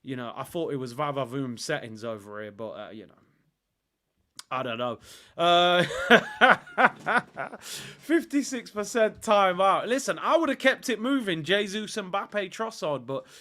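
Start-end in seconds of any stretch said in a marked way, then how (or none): 5.9-7.5 short loud laugh. Real, not acted. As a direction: burst of real laughter. Laughs at own joke.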